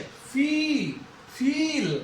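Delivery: फी, then फील, each with a held ee; long vowel demo. Shown as a demonstration. Teacher isolating the long sound, held vowel. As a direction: draw out the ee in फी and फील. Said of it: "long sound जा रही है".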